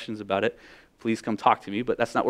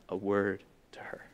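hushed whisper; 5 semitones lower, 12 dB quieter than usual. Acted. Dramatic pause in sermon story.

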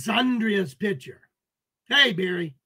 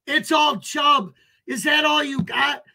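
higher, louder jeering shout; shouted taunt; 4 semitones higher, 4 dB louder than usual. Real, not acted. Streamer yelling a dare at trolls, shouted taunt. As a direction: yell it right at them, daring them.

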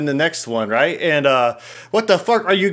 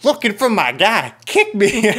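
higher mock girly voice; imitating the girl; 6 semitones higher than usual. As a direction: play the cheesy girl at the bar. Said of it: "then she'd be like".

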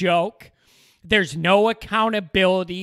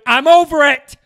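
exasperated shout, higher and much louder; fed-up outburst; 7 semitones higher, 10 dB louder than usual. Real, not acted. Fed-up fan venting about the Bears, exasperated loud shout. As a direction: blurt it out, totally done.

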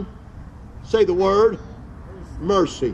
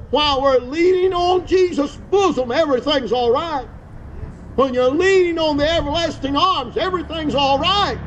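rising preaching; 5 semitones higher than usual. Acted. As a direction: lift into a higher, ringing preaching voice. Preacher rises into higher preaching.

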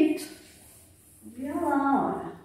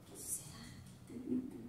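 suspense whisper; 24 dB quieter, much faster than usual. Acted. Reaching into mystery box.

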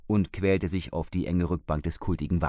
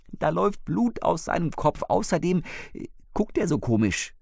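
higher, a hint of an anxious wife; lightly voiced frightened older woman; 10 semitones higher than usual. Wife reports the dead man to husband, lightly anxious. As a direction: let a shaken woman report it.

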